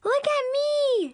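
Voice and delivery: put-on very high, stretched little-girl squeal; show-off little sister. Little sister doing the robot, squealing. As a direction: sing out for attention, high and slow.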